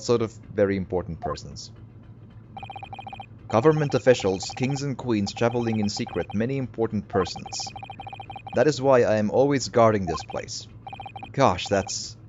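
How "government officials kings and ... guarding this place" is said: put-on lightly Russian-accented boastful guard; Russian-accented sailor. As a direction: brag about the VIPs in a mild Russian accent. Sailor character voice.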